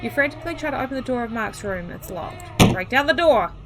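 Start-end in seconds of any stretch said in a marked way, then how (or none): 2.7-3.5 loud, urgent command; urgent shout. Acted. Player acting out the frantic attempt at Mark's door, urgent shout. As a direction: shout it like an emergency.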